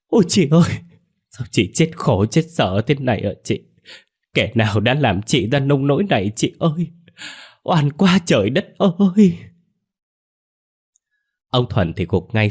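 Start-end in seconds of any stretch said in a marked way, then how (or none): 0.1-9.4 grief-stricken wailing. A narrator's reading. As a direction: cry out in anguish, stretching out the final lament. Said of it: "Cái Đào và cái Mơ gào lên". (gào khóc thảm thiết)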